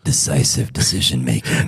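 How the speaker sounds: low, quiet, deep voice. Shown as a demonstration. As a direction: drop the voice way down. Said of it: "Say that in that deep Kerry voice".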